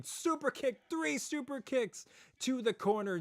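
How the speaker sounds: high, excited play-by-play call; wrestling commentator. Acted. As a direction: call every kick like ringside. Live play-by-play of the match.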